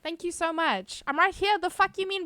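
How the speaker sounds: ordinary delivery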